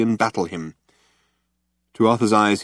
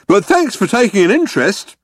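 high, loud, polite guard; courteous guard dismissing prisoners; Vogon guard; 12 semitones higher, 6 dB louder than usual. A narrator's reading. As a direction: thank them in a big official voice.

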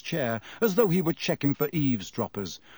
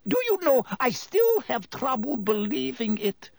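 high-pitched, musing disbelief; thoughtful; 11 semitones higher than usual. A narrator's reading. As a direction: wonder aloud. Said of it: "said Madame Rossmerter thoughtfully".

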